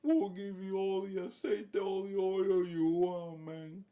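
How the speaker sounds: high, drawn-out pleading; hammed-up, whiny begging for mercy; Nicolás Maduro. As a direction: beg slowly and desperately in a put-on voice. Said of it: "that's Nicolas Maduro right now. Right, just begging Donald".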